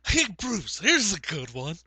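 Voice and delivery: hammed-up booming old announcer; Harry Carey. Agent slipping into announcer's voice for a story, hammed-up impression. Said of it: "I almost slipped into Harry Carey".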